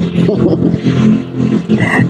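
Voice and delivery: long real laugh at own joke. Real, not acted.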